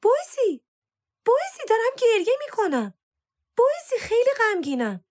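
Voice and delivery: very high-pitched mock-tearful yell; wailing cry. A narrator's reading. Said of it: "سارا فریاد کشید".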